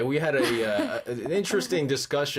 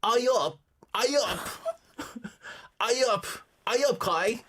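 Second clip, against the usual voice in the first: mock Yorkshire greeting; 6 semitones higher than usual. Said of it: "it sounds like proper English".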